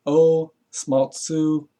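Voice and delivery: O Smaltsu stretched very slowly; drawn out. Shown as a demonstration. Spelling the word for viewers, drawn out. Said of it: "it's O S U".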